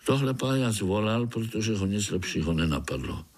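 usual speaking voice

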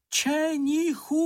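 high, long-drawn distant call; high hallooing cry, held long. A narrator's reading. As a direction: call the name far across the forest, stretched out. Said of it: "zakřičel ze všech sil".

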